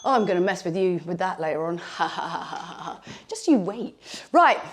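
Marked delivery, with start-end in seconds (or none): 2.0-3.0 soft, low villain chuckle; a hammed-up quiet evil laugh; teasing evil laugh at the viewers; mock evil laugh. Teasing threat to mess with viewers.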